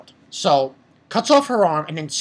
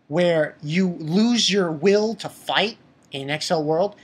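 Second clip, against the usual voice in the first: ordinary talk, nothing marked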